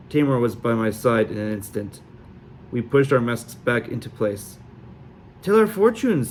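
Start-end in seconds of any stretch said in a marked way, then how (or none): 5.4-6.3 put-on high, pushy young girl's demand; high-pitched demanding girl. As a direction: insist on it like a tipsy girl. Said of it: "the tall girl demanded".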